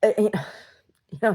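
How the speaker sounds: normal talking voice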